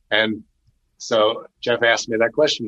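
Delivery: ordinary talk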